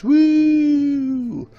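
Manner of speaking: a long high cheer; high woo. Acted. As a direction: celebrate triple digits with a yelp. Cheer for episode one hundred.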